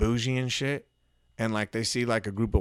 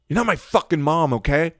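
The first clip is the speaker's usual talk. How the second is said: exasperated snap, louder and higher; retold angry outburst, pitched up; snapped; 5 semitones higher, 5 dB louder than usual. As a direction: lose patience and snap, retelling it. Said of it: "I just snapped".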